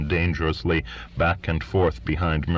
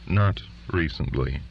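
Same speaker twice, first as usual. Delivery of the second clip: usual speaking voice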